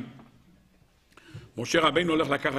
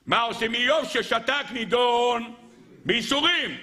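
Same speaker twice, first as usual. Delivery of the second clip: Talmudic singsong; 6 semitones higher than usual. Acted. Preacher reciting Job's sentence, in singsong. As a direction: chant it, stretching toward the end.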